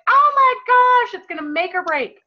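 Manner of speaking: over-the-top pretend alarm; mock panic. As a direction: ham up the panic like it's the end of the world.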